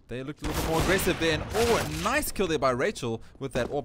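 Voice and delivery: sudden burst of loud live commentary; excited play-by-play. Acted. Calls a sudden kill.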